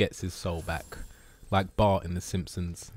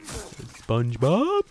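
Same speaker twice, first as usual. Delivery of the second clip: SpongeBob attempt; 3 semitones higher, much slower than usual. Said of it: "I can do SpongeBob".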